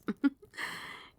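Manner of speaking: short, airy giggle; fond chuckle. Acted. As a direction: chuckle warmly at a pet. Queen pleased with her pet.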